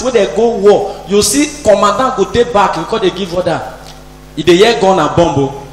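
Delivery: ordinary talk, nothing marked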